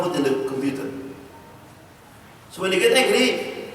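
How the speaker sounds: usual speaking voice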